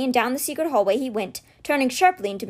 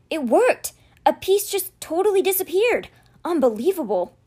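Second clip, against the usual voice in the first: excited; 5 semitones higher than usual. Acted. Amazed at spell working.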